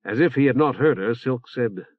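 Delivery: plain reading voice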